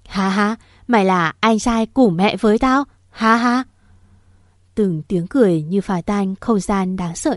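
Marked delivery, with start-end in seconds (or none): none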